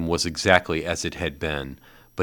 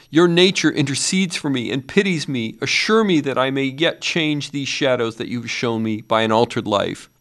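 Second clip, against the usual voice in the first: higher, louder imploring; pleading; 8 semitones higher, 4 dB louder than usual. A narrator's reading. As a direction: beg for a second chance. Scrooge pleads on ground.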